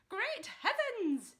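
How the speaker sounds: high, loud, slow outburst; an excitable father crying out; excited cry. Acted. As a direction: burst out in amazement. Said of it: "he cried".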